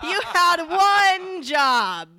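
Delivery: loud, high, slow scolding; exasperated yell. Retold or imitated. Host telling off her cohost, loud slow scold. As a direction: drag out each word in disbelief.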